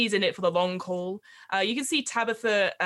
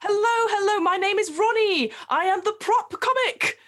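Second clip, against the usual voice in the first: prop comic voice; 8 semitones higher than usual. Acted. GM voicing Ronnie the prop comic.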